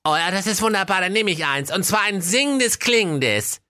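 high, fast delighted exclaim. Acted. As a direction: gush happily, rushing. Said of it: "rief er entzückt". (entzückter Ausruf, hoch und schnell)

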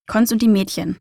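usual speaking voice

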